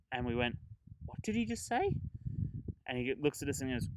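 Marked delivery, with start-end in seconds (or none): 1.0-1.9 high, disbelieving squeak; can't believe what he just heard; mock shocked, much higher; incredulous. Disbelief at stranger's answer.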